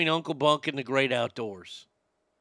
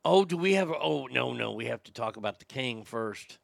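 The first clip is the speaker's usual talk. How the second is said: ordinary talk, nothing marked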